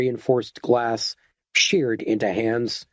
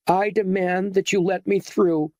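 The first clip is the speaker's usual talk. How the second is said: higher, imperious demand; haughty insistence; 8 semitones higher than usual. A narrator's reading. Esmeralda haughtily demands Garnish let her through. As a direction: look down on him and insist. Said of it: "insisted Esmeralda, suddenly haughty".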